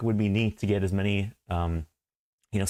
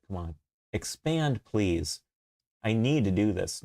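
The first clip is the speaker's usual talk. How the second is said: ordinary talk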